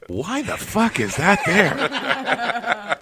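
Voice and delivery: high, slow, laughing disbelief; drawn-out baffled question. Pretend. Future reader baffled.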